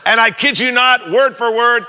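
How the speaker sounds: ordinary talk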